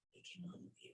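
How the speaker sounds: softly whispered, almost no voice. Real, not acted. Medium to grieving father, whispering the comfort. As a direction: breathe it out tenderly. Repeats line, second whispered.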